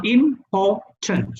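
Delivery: "important" slowly split, im, por, tant; a clear gap after each syllable of "important". Shown as a demonstration. Teacher repeating a three-syllable example, syllable by syllable. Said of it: "So that's three syllables".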